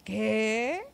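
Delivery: mock-incredulous, rising loud question; imitated astonishment. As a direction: imitate a friend's amazed outburst. Voices friends amazed at her job.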